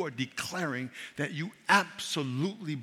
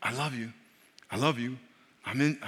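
tender voice of the Spirit; 6 semitones lower than usual. Acted. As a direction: speak it gently like a loving whisper of God. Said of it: "And he's like".

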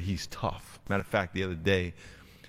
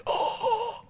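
mock groan. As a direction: groan like a sick kid still running. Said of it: "they're out there like this".

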